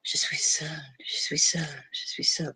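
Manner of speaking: high, soft unvoiced echo; echo whisper. A narrator's reading. The echo answering the prince, a soft high whisper. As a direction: whisper it back like a faraway echo. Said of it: "responded the echo".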